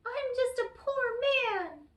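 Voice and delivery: high, whiny plea; whining leprechaun. Acted. The caught leprechaun pleads poverty to Tom, high whine. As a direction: whine like a caught little man. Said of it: "wiggled and twisted and whined".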